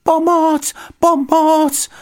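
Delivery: very high, loud distress cries; panicked high shouts; calling for help. A narrator's reading. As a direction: shout for rescue at the top of your range. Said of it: "volal Paddington".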